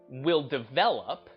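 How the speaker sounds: ordinary talk, nothing marked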